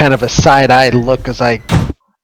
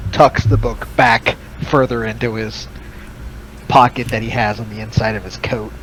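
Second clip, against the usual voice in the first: normal talking voice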